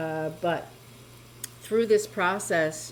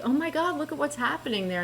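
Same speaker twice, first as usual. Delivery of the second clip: much higher, fast amazed outcry; astonished guard; 8 semitones higher, faster than usual. Retold or imitated. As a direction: play the startled guard pointing, blurting it out fast. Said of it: "he's pointing at it like".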